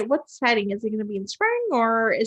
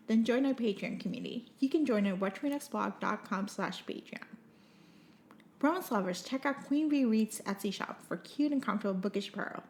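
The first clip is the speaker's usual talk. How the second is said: softer, even scripted promo read; quiet, measured ad read; podcast outro read; 2 semitones lower, 10 dB quieter than usual. Acted. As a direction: read the show's sign-off and sponsor plug. Reads podcast outro and sponsor ad.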